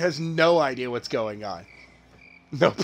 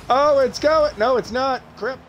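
sudden high excited yelp; 8 semitones higher, 6 dB louder than usual. Real, not acted. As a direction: shout it as the ball rolls. Ball nearly goes in.

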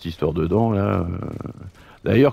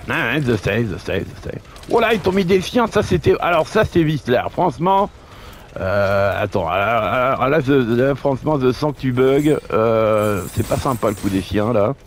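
higher teasing sidekick voice; a cheeky buddy, pitched up; Roger voice; 5 semitones higher than usual. Pretend. Roger voice, complains about dogs.

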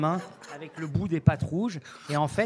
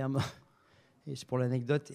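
normal talking voice

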